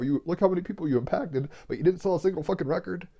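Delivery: moved almost to tears as you speak; getting emotional. Real, not acted. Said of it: "Sorry, I'm getting all emotional".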